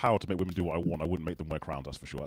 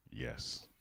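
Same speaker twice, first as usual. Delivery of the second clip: ordinary talk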